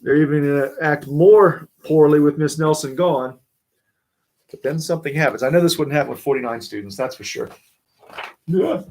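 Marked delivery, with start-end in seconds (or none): none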